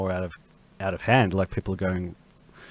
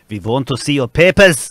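put-on loud, higher German-accented guard; mock German accent; 5 semitones higher, 12 dB louder than usual. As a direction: bellow it in a hammed-up German accent. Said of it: "the security guard came up and said".

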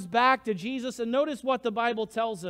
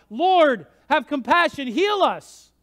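loud, raised plea to the Lord; crying out; 4 semitones higher, 6 dB louder, slower than usual. Retold or imitated. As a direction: call out for mercy as the lepers did. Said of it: "as they cry out".

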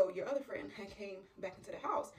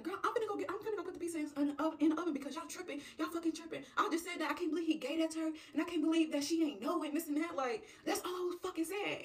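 high, hurried self-defence; indignant; 8 semitones higher, faster than usual. Acted. Friend told off by the group, indignant. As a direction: argue your case, pitched way up. Scolded friend argues back.

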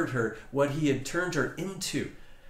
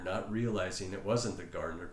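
ordinary talk, nothing marked

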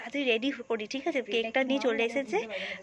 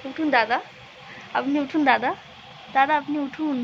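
mock-polite wake-up call; 2 semitones higher than usual. Mock-formal waking sleeping friend.

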